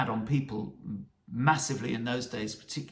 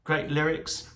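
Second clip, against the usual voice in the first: ordinary delivery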